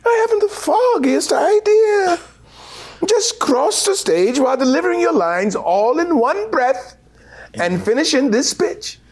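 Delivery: very high, exasperated posh English; British director impression. Director waving off motivation, very high English voice. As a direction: soar up, dismissive. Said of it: "He said".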